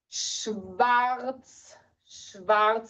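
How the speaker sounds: schwarz with a clear sch and v sound; German sch. Shown as a demonstration. Teacher showing families the sch in schwarz. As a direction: say schwarz, sh then v, rolled r. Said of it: "the sh sound is the S C H".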